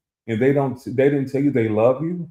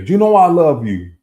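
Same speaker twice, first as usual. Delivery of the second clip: loud, higher quote of mom; imitating mother; 7 semitones higher, 10 dB louder than usual. Mother to her child, loudly imitated declaration. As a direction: belt it out as mom's pet saying.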